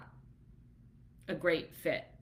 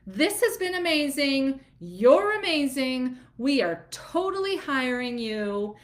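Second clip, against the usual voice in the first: thrilled client; 5 semitones higher, 4 dB louder than usual. Retold or imitated. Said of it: "they were like".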